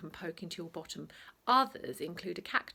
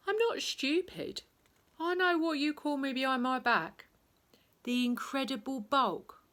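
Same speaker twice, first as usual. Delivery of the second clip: high, pained protest; hurt protest; 7 semitones higher, 5 dB louder than usual. Acted. Teacher answers his mocking team, high and hurt. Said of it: "began speaking slowly and softly".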